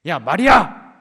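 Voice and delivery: loud barking call of 야, 마리아; harsh shout. Shown as a demonstration. Preacher shows a wrong way Jesus might call Mary, shouting. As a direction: yell the name like a scolding. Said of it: "이렇게 하셨겠어요?".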